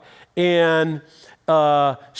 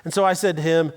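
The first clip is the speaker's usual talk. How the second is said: normal talking voice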